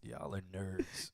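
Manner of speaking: slow teasing whisper. Real, not acted. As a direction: breathe it out slowly, teasing. Teasing chat about nerding out.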